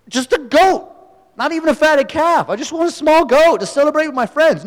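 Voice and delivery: sulk, then whine for the goat; whiny grievance. Retold or imitated. Older son complaining to his father, whining.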